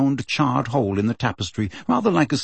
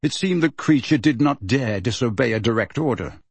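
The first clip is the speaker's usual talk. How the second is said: ordinary delivery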